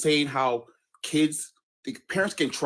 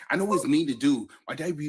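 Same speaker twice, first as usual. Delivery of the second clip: ordinary talk, nothing marked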